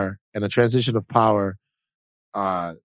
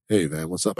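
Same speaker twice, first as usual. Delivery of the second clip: laid-back Jesus; 8 semitones lower, 4 dB quieter than usual. Pretend. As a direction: say it like a chill buddy Jesus, low. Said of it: "That's how he sounds to me".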